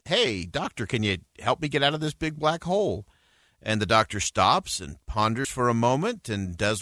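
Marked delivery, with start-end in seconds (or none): 0.1-3.1 raised-pitch plea, not truly shouted; mild calling out. A narrator's reading. Trapped man calls up to a passing doctor, mild calling out. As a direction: call up for help without much volume. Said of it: "so the guy shouts up".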